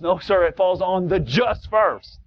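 normal talking voice

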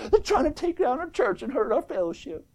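high, hushed fretting; mock nervous. Preacher mimics nominal believers fearing for their church, nervous. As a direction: imitate a jittery churchgoer, high and soft. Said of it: "they get all nervous and shaking in their boots".

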